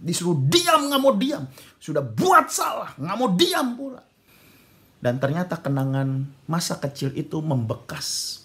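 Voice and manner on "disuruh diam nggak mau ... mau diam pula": high, loud, drawn-out rebuke; angry scolding. Retold or imitated. Father berating his disobedient son, angry scolding. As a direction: scold hard as the fed-up father.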